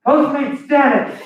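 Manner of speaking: loud, high announcing yell; shouted order call. Retold or imitated. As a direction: call it out over the crowd. Yelling order at crowded counter.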